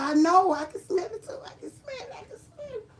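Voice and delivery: very high squeal thinning away; overjoyed. Retold or imitated. Retells joy at regaining smell.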